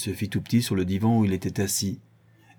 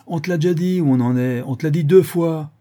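loud, high, irritated repetition; exasperated; 8 semitones higher, 8 dB louder than usual. Acted. Rueil tells Jean they said it twice, exasperated. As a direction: drive it home impatiently. Rueil snaps back after shouting.

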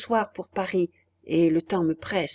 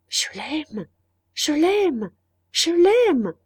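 starts restrained, rises higher and louder each time; building insistence; 7 semitones higher, 4 dB louder, much slower than usual. A narrator's reading. Woman pushing back remorse, increasingly fervent self-assurance. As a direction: repeat it with mounting fervour.

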